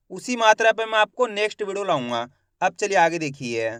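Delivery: ordinary delivery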